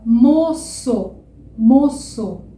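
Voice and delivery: "moço" very slow, mo-sso. Shown as a demonstration. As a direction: draw out "moço" with a clear s. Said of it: "Por isso que eu falo". ("moço" bem devagar com s)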